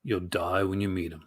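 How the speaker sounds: low, ominous trailer line; a hint of lurid trailer growl; movie trailer voice. Shown as a demonstration. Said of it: "movie trailer guy voice".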